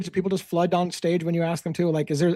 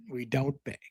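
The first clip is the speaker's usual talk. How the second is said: quiet repeat; 5 semitones lower, 11 dB quieter, slower than usual. Real, not acted. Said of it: "speaking of I don't beg".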